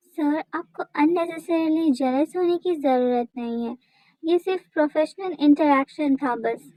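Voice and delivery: ordinary delivery, nothing marked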